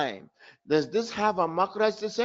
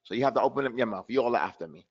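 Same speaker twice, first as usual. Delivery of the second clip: normal talking voice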